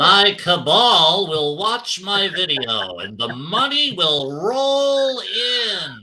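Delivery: slow gloating villain, mock evil; scheming villain voice. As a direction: drawl it like a cartoon schemer. Mocking greedy streamer.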